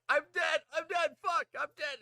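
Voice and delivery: loud, high-pitched panicked cries; mock panic. Player retelling the moment the ghost popped up, loud mock panic.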